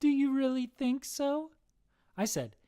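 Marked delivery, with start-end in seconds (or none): 0.0-1.5 put-on high-pitched girlish question; giggly girl. A narrator's reading. Girl answers a compliment, high girlish voice. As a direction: ask in a high, flattered girl voice. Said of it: "The girl giggled and said".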